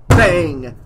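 loud, punched, falling blow; exclaimed bang. A narrator's reading. Old man's cane hits the floor, loud bang. Narrator voices the cane blows.

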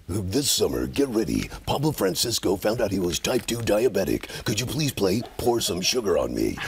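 deep dramatic trailer announcer; low booming trailer voice; movie trailer voice. Retold or imitated. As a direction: make it sound epic like a preview. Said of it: "make anything sound exciting and cool".

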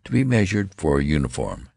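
ordinary delivery, nothing marked

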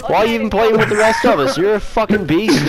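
high, hammed-up hype with laughter; played-up awe. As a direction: lay the praise on thick, amused. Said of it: "I gotta play it up".

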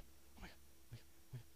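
hushed, barely voiced repetition; tense whispering. Real, not acted. Player right after expecting a scare, tense whispering. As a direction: mutter it under your breath in dread. Tense muttering during game.

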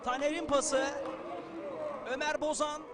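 loud, energetic play-by-play; live football commentary. Real, not acted. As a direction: keep the post-goal energy up. Energetic play-by-play after goal.

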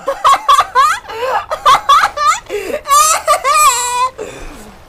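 high, loud cackling laughter; helpless high-pitched laughing; laughing fit. Real, not acted.

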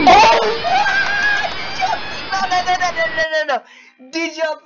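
very high screaming excitement, easing slightly; hyped-up high-pitched gamer squealing; getting hyped. Real, not acted. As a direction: shriek and stammer in real disbelief. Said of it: "I'm getting hyped over nothing".

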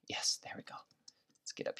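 hushed unvoiced whisper while hiding. Real, not acted. Gamer whispering to viewers while hiding from zombies. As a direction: whisper so the others can't hear. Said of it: "I don't know why I'm whispering".

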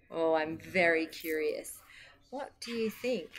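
ordinary delivery, nothing marked

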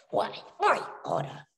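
ordinary delivery

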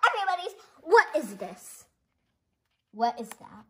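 normal talking voice

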